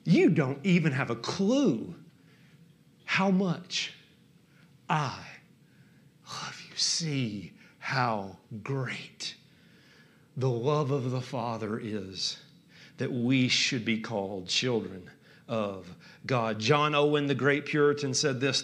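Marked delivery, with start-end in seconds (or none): 0.0-6.8 low, hushed, with long pauses; softening, heartfelt, fading to near silence; God's tender reply. Acted. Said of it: "God's just saying". 6.8-16.6 low, soft, very slow; hushed, awed recitation; reverent scripture reading. Real, not acted.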